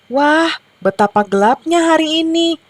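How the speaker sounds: high-pitched storybook lament; mild complaint. A narrator's reading. Hens find the morning dark, lightly complaining. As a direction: complain in wonder, pitched well up. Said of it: "keluh mereka".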